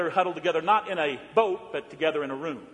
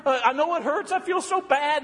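higher, fretful imitation of a tearful mother; mock crying mom; 8 semitones higher than usual. Mother driving her injured son to hospital, mock tearful and fretful. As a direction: play a frantic mother fussing over her son, pitched up. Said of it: "Mom was a basket case, crying".

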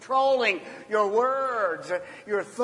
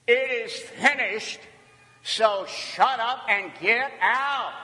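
raised, forceful proclamation; triumphant command; 3 semitones higher than usual. Acted. Jesus facing Satan from the cross, triumphant command. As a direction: proclaim victory, then order firmly.

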